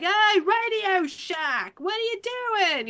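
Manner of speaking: high, slow calling out; high drawn-out call. Retold or imitated. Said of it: "she's like".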